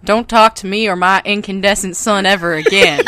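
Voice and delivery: loud, laughing southern read; southern accent. Shown as a demonstration. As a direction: read his tweet in his southern accent, cracking up. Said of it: "read this, 'cause that's a tweet of his".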